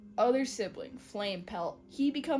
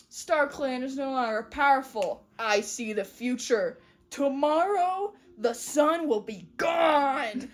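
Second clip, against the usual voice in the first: louder, building to drawn-out mock doom; dramatic prophecy; 5 dB louder than usual. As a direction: proclaim it like a hammy prophet. Acting a doomsday prophecy.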